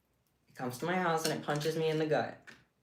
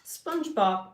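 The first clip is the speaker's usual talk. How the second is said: poetry read; 5 semitones higher, 3 dB louder, slower than usual. Pretend. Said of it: "I'm doing live poetry".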